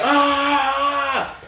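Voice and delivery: long high "aah"; mock drawn-out cry; imitated wail. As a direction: act out someone hollering on the ground. Imitates man wailing on the floor.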